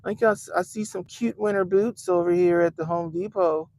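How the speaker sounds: lightly voiced silly protester; a hint of a clueless activist; teasing impression of a protester; mock protester. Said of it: "protesting for the illegal immigrants talk about".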